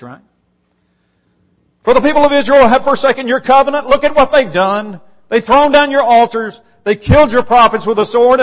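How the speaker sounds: high, loud and fast, an aggrieved prophet pleading; Elijah's complaint. Acted. Elijah answers God, pouring out his grievance loudly. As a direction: pour out the grievance in a rush. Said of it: "listen to his answer".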